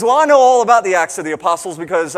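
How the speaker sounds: blurting his knowledge at me; a loud, eager old man bragging; old man's boast. Retold or imitated.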